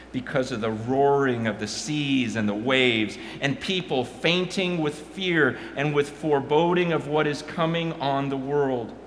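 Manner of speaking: reading scripture, building to a loud climax; dramatic Bible reading rising in force. Acted. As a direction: let the prophecy swell.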